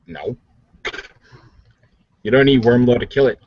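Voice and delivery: ordinary talk, nothing marked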